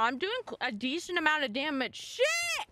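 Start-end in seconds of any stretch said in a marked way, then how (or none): 2.0-2.7 sharp, high curse; high frustrated yelp. Real, not acted. Player whose attack just failed, sharp high yelp. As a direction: spit the curse out the moment it goes wrong.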